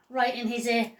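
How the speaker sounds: plain reading voice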